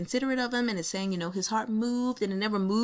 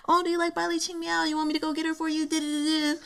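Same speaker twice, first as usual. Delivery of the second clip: high, quick teasing friend voice; teasing Xu Yanyan; 8 semitones higher, much faster than usual. Retold or imitated. Reader voicing Xu Yanyan teasing him, high and quick. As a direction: lightly mimic a teasing offer. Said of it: "Xu Yanyan was like".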